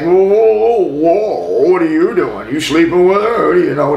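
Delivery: loud, high, confrontational whoas; aggressive challenge. Retold or imitated. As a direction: get in his face, voice up and loud. Guys confronting narrator at bar.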